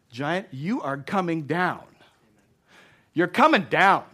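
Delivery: defiant declaration building to a loud shout; worked-up defiance. Acted. David tells the giant he will fall, worked-up. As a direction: declare the enemy's downfall, building in force. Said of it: "I'm getting worked up up here".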